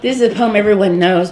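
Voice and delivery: normal talking voice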